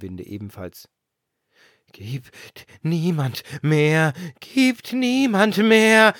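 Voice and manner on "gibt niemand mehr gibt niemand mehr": very slow, much louder howl; blowing as loud as possible. A narrator's reading. The wind howling for more gifts, very loud. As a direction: blow it out like a storm. Blies er so laut er konnte.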